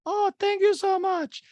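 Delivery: very high, slow, loud falsetto; hammed-up gushing squeal; Diana impression. Diana thanks Garcelle for a gift, mock falsetto. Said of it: "And Diana goes".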